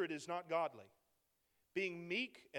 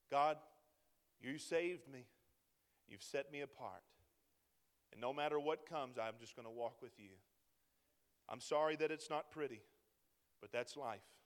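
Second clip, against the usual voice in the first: much softer, lower, intimate prayer; quiet sincere prayer; 7 semitones lower than usual. Retold or imitated. Believer quietly telling God he will keep walking, soft and sincere. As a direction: speak to God gently and humbly. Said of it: "my eyes have been on him".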